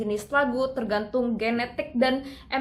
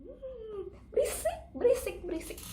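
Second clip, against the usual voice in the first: mock complaint; 9 semitones higher, 7 dB quieter than usual. Mocking a noisy singer.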